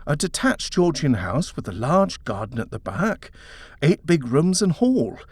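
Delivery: husband gushing about the Georgian house, excited. A narrator's reading. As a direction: paint the dream house with rising excitement. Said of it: "Edward said excitedly".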